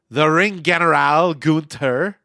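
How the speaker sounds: put-on slow, loud ring-announcer Scottish accent; mock Scottish accent, drawn out and booming. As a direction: roll it out like a hammy Scottish announcer. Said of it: "That is my Scottish accent".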